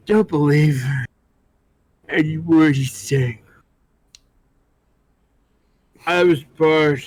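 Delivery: put-on drunk old man. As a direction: slur it like a boozy old drinker. Drunk old patron rants.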